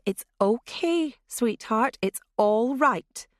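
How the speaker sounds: slow, gentle calming; mother calming a snarling child; soothing. Acted. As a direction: soothe her like a frightened animal. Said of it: "as she might to a mean dog".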